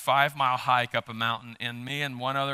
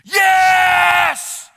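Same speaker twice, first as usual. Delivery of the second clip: retold triumphant shout, much higher and louder; joyful yell; 12 dB louder than usual. Pastor retelling yelling from a cliff top, joyful shout. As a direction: yell yes from the mountaintop. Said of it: "I think I just yelled".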